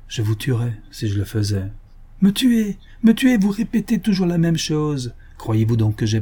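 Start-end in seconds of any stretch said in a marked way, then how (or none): 2.2-5.1 higher, scoffing retort at once; scornful outburst. Acted. Baronet mocking the doctor's warning, scornful. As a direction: throw his words back with scorn.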